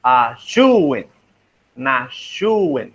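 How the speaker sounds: "shuwen" with a strong, falling "SHU"; stressed syllable shown. Shown as a demonstration. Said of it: "there is a stress".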